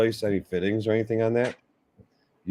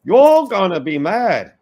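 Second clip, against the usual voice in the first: gleeful teasing; 9 semitones higher, 7 dB louder than usual. Real, not acted. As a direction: break in loud and high, stretching it out. Teasing interruption with bad news.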